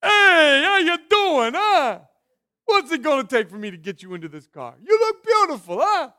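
high, loud slick salesman pitch; used car salesman. Retold or imitated. Preacher playing a car salesman to his congregation, high and loud. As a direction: schmooze the buyer like a pushy car dealer. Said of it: "Used car salesman".

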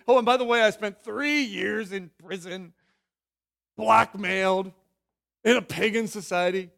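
lightly voiced Joseph, breezy and forgiving; Joseph forgiving brothers. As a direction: shrug off the wrongs as Joseph would, lightly. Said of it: "for somebody to speak like that".

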